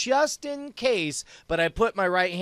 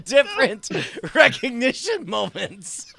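high, loud words through real laughter; laughing hard; 8 semitones higher, 5 dB louder than usual. Real, not acted. Cracking up over different recognition moments, laughing hard. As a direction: barely get it out for laughing.

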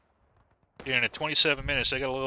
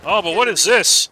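louder, higher, slow hype build-up; excited commentary; 6 semitones higher, 4 dB louder, slower than usual. Acted. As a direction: sell the big reveal, drawn out. Caster hypes a new attack.

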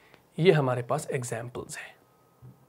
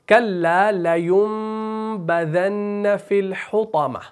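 very slow chant with long held nasals; Quran recitation; 3 semitones higher, much slower than usual. Shown as a demonstration. Teacher shows how the famous verse is read, slow chant. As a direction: recite slowly, stretching each long vowel. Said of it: "आप क्या पढ़ते हैं".

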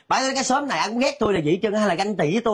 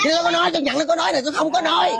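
high, fast indignant protest; flustered denial; 8 semitones higher, faster than usual. Acted. Cornered gossip denying what she said, flustered. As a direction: scramble to deny it, pitched up.

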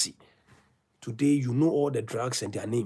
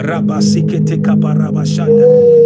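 loud rapid glossolalia; speaking in tongues; 3 semitones higher, 13 dB louder than usual. Real, not acted. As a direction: pray in tongues with fervor. Tongues during loud prayer.

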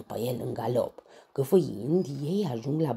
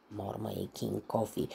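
ordinary delivery, nothing marked

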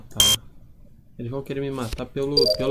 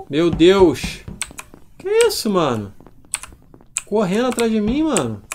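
startled panic; 6 semitones higher, 5 dB louder than usual. Real, not acted. Chased in game, startled.

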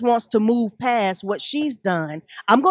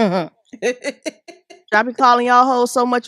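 laughing; 4 dB louder, much slower than usual. Real, not acted. Laughs while joking with co-host.

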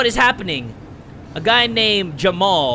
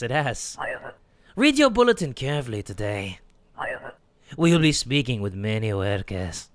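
lightly voiced sinister official; 6 semitones lower than usual. Voicing scary official.

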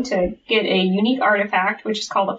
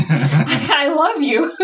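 slow, high mock-romantic line through laughter; laughing declaration; 5 semitones higher, much slower than usual. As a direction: crack up while declaring love. Acted lover's line breaks into laughter.

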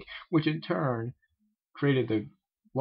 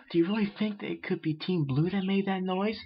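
Fluttershy voice; 9 semitones higher than usual. Acted. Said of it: "that's Fluttershy".

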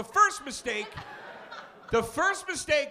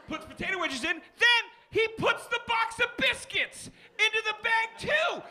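excited disbelief; 10 semitones higher than usual. Real, not acted. Escalating disbelief.

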